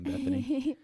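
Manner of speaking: cartoonish high-pitched call; high squeaky voice. Calling co-host in a high voice.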